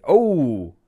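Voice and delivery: hammed-up jungle show presenter. Ant and Dec open the evening's show, hammed-up presenter voice.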